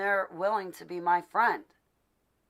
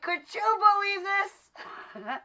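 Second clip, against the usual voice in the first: much higher, slow, disbelieving; high-pitched astonished aside; incredulous squeak; 11 semitones higher, slower than usual. Retold or imitated. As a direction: squeal it in mock shock. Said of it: "I was like".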